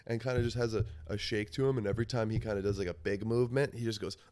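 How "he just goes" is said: usual speaking voice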